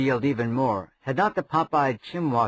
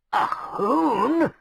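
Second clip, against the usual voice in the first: high, shrill croak of horror; appalled croak; 11 semitones higher, 4 dB louder than usual. A narrator's reading. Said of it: "croaked Chimwazzle, appalled".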